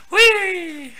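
long high "whee", gliding down; playful high-pitched squeal; gleeful whee. Pretend. Player follows the flight in the game, gleeful whee. Playing along with flying object.

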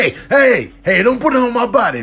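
high, loud hurried hey; sharp protest. Real, not acted. Telling the masseur off the body, loud. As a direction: yell at them to stop. Yelling at the massager.

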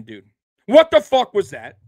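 angry retort. Retold or imitated. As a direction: demand an answer from the coach, half-acted. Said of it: "And I'm saying".

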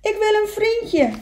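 high, loud plaintive cry; echoed call. Acted. Echo answering the beaver across the lake, loud high call.

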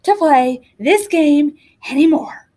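breathless, gasping for air; panting between words; out of breath. A narrator's reading. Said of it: "in between gasps of air".

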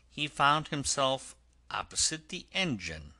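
usual speaking voice

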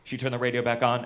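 usual speaking voice

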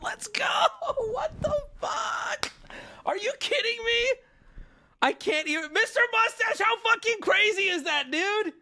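high, squealing disbelief; real overjoyed amazement; giddy freak-out. Real, not acted. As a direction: squeal as the win sinks in. Celebrating the card pull again.

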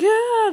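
a high, loud little yelp of fright; mock startled yelp. Vlogger voicing a comic character's freak-out, high yelp. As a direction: squeak like a comic character in shock. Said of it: "He's like".

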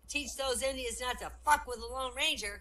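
put-on high, loud little-boy voice; emphatic schoolboy punchline; little Johnny. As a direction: blurt the lesson out like a cocky kid. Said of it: "Johnny goes".

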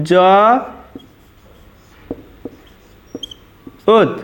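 "জ", long pause, then "ওত"; syllable by syllable. Shown as a demonstration. Teacher writing out the pronunciation, one sound at a time. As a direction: split "জ ওত" into separate sounds. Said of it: "উচ্চারণটা হবে".

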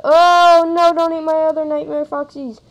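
loud, high-pitched hammed-up cry; exaggerated mock wail of protest; mock panicked plea. Mock plea to opponent in game.

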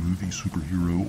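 ordinary delivery

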